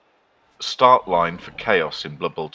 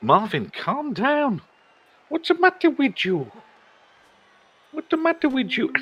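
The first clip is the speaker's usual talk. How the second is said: pet scolding. Real, not acted. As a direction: fuss over the cat in a high pet voice. Cat misbehaving on stream.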